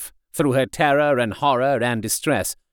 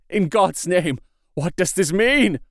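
higher-pitched cry of alarm; 6 semitones higher than usual. A narrator's reading. Husband waking to a horrifying scene, crying out in alarm. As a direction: demand an answer in shocked alarm. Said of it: "Harker cried out".

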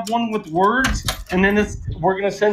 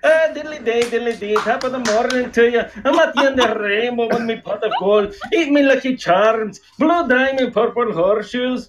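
hammed-up Irish leprechaun accent; Blarney Kalakalani. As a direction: put on a singsong stage-Irish leprechaun brogue. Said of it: "one of my characters, Blarney Kalakalani".

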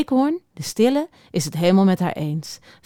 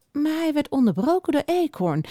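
plain reading voice

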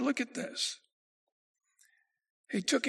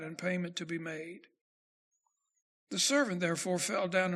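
measured, lower scripture-reading voice; 4 semitones lower than usual. Acted. Preacher reads Matthew 18 aloud.

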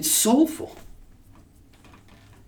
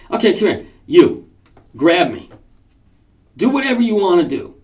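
loud, raised-pitch commands with gaps between; bold challenge; 4 semitones higher, 5 dB louder than usual. Retold or imitated. Martial artist daring violent people to grab him, bold challenge. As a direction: call them out one command at a time.

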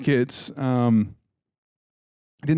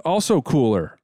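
ordinary talk

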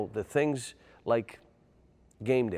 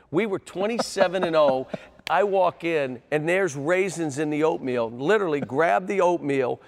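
loud, high, indignant storytelling; real exasperation, raised voice; worked-up rant; 6 semitones higher, 7 dB louder than usual. Real, not acted. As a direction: relive the outrage at full volume. Ranting about raisins in oatmeal.

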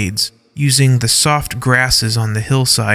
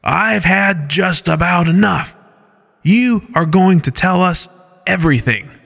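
higher, menacing growl of a threat; 6 semitones higher than usual. Acted. Derek threatens Skyla, growling. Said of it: "Derek says in a growling voice".

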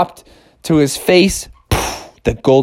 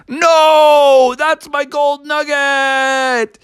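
drawn-out desperate high cry; anguished slow cry; 10 semitones higher, much slower than usual. Acted. Bubby crying out as the eagle steals his nugget. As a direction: wail it as the prize flies off.

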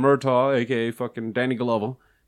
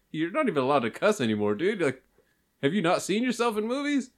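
ordinary talk

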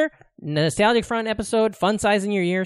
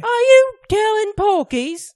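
put-on high, slow English accent with a louder voice; hammed-up Monty Python Englishman; 7 dB louder, slower than usual. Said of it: "Monty Python fun size today".

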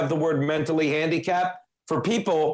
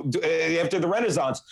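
ordinary delivery

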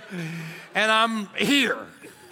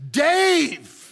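called out name; 7 semitones higher, 4 dB louder than usual. Retold or imitated. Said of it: "I hear this".

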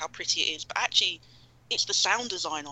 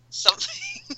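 laughing; much slower than usual. Real, not acted. Coy pause before naming game.

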